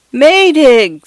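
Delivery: high desperate cry into the storm; a thin voice screaming a name; feeble scream. A narrator's reading. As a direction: scream for help over the gale. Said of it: "screamed Mister Fotheringay's feeble voice".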